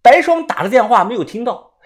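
ordinary delivery

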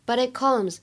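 "but it comes" as one linked unit, t of "it" unreleased; linked, unreleased stop. Shown as a demonstration. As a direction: flap into "it", then stop the t before "comes". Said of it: "又有一个完全失去爆破的现象". (“but it comes”连读，it的t不爆破)

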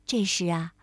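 usual speaking voice

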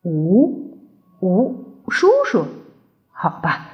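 halting dinosaur voice, trailing off; lightly voiced big dinosaur, taken aback; reluctant tyrannosaurus. A narrator's reading. T. rex reluctantly accepts new name.

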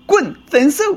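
high-pitched mock outburst; angry dismissal. Husband dumping his cheating wife, angrily dismissive. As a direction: throw them out in a high voice.

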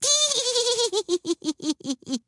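very high, long giggle that breaks into little hees; little woman's piping laughter; high tittering laugh. A narrator's reading. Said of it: "laughed the little woman".

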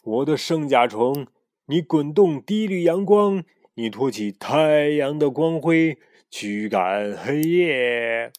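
slow, grand pharaoh chant; solemn incantation. Acted. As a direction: intone like an ancient king.

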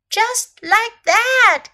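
high, slow, triumphant-style call; emphatic storybook snake cry; Snake exclaiming. A narrator's reading. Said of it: "cried Snake".

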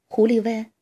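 plain reading voice

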